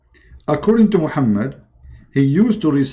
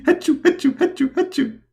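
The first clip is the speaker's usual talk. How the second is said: high, rapid mock sneezes; comic forced achoos; fake sneezing; 13 semitones higher than usual. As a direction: squeak out a string of badly faked sneezes. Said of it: "I'm going to sneeze immediately".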